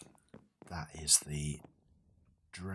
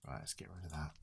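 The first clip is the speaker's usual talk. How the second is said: whispered; 11 dB quieter, much faster than usual. Real, not acted. Muttering to himself.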